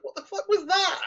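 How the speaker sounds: squeaky high, cracking into laughter; mock-baffled, laughing through it; high-pitched laughing what-the-hell; laughing disbelief. Bewildered reaction in retold scene.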